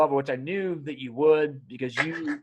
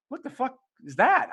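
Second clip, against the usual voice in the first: high-pitched incredulous question; retold puzzled disbelief; mock bafflement; 9 semitones higher than usual. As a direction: question your own blunder, pitched way up. Reacting to own made-up word.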